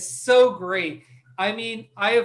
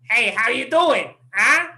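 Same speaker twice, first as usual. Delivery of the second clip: lightly put-on goblin voice, louder; 3 semitones higher, 6 dB louder than usual. DM demonstrating an NPC to a new player, goblin voice. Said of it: "a goblin might be like".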